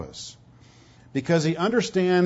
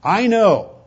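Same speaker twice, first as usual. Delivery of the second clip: I KNOW raised in pitch and volume; Paul's emphatic statement; 6 semitones higher, 6 dB louder than usual. Retold or imitated. Preacher repeats the apostle's words from the verse, emphatic. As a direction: land "I know" hard and high. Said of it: "It's emphatic".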